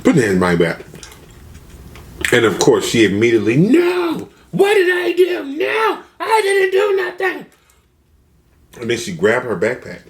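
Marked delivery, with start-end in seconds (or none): 0.0-0.8 lower, firm police command; fed-up officer. Retold or imitated. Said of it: "he's just fed up". 3.6-7.5 very high wailing squeal of protest; hammed-up woman shrieking her innocence; mock panicked shriek. Acting out woman protesting her arrest.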